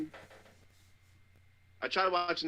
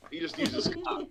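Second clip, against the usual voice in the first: high-pitched, laugh-filled punchline; cracking up while landing the joke; laughing through it. Real, not acted. Friend jokes about a show character, breaking into laughter.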